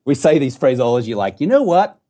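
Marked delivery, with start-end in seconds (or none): 1.4-2.0 high-pitched American catchphrase; exaggerated, higher American impression; mock American accent. Shown as a demonstration. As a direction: tease Americans with their own accent. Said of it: "this is how Australians mock".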